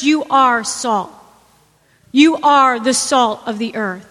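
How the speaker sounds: weighted repeats on "You are"; emphatic declaration. Acted. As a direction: hammer "You are" home each time. Preacher repeats Jesus' declaration.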